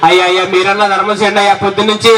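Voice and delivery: sing-song beggar's plea for alms; whining beggar. Acted. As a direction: wheedle like a street beggar working the crowd. Beggar act asking for alms.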